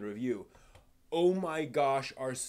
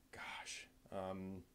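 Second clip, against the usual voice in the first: whisper; 5 semitones lower, 14 dB quieter than usual. Real, not acted. Whispered aside.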